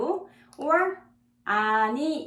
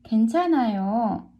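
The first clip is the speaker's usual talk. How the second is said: brisk model; 3 dB louder, much faster than usual. Shown as a demonstration. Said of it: "One more time".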